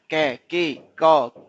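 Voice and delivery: कै, के, क spelled out sound by sound; the k sound sounded out slowly. Shown as a demonstration. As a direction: isolate each k sound, क punched loud. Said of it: "तो sound किसकी आती है".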